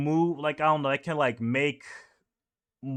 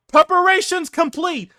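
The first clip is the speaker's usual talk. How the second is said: high, loud call across the deck; mock soldier yell; 8 dB louder than usual. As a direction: bellow the order to the crew. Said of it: "yelling out".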